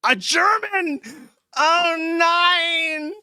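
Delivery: hammed-up high German accent, shouting; mock German accent. Mocking a chatter's German origin in a hammed-up German accent. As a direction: squeal it like a cartoon German. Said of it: "What a shitty accent".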